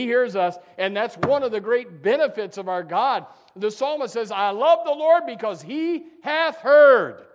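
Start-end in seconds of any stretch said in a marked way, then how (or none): 4.3-7.3 slow, louder, higher psalm reading; ringing preacher's scripture recitation; fervent scripture reading. Acted. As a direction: declaim it slowly like a psalmist. Preacher reads psalm with fervor.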